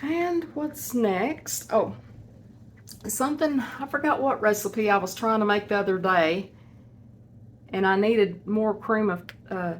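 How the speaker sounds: usual speaking voice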